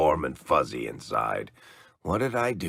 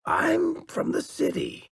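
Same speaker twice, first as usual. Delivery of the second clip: much higher, a fed-up cry; exasperated outburst. Real, not acted. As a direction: burst out in frustration at the terrain. Complaining about rough terrain.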